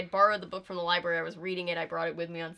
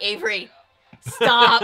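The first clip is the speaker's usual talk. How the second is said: loud mock protest, building to a higher shout; exasperated scolding; 3 semitones higher, 13 dB louder, slower than usual. Family begging joker to stop, mock exasperation. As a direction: imitate a fed-up relative cutting someone off.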